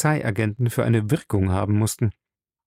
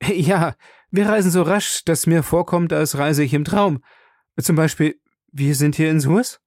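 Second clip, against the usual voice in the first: higher-pitched, chatty traveller; chatty Passepartout; 6 semitones higher than usual. Acted. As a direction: play a talkative servant marvelling at his trip. Voices Passepartout in the story.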